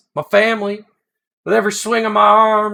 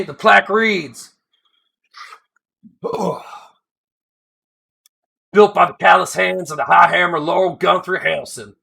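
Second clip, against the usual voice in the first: story voice of a gruff, homespun dwarf; weathered dwarf spinning his tale; folksy old dwarf. Acted. Folksy dwarf narrator reading story.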